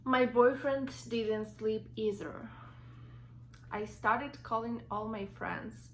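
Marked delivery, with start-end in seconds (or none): none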